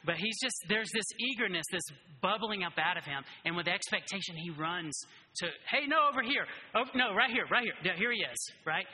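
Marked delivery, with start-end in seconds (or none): 5.7-8.5 much higher, louder eager shouts; excited calling out. Retold or imitated. Blind beggar running toward Jesus, eager excited calls. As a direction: call out eagerly, hunting for him.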